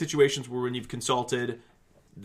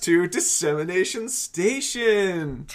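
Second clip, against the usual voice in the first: excited presenter; 8 semitones higher, 6 dB louder than usual. Acted. Hosts introduce the podcast segment.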